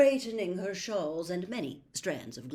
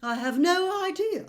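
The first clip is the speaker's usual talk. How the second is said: higher, airy and surprised; taken-aback seer; 12 semitones higher, 9 dB louder than usual. A narrator's reading. Trelawney surprised by Harry's urgency, airy. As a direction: recoil a little, airy. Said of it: "taken aback by the urgency".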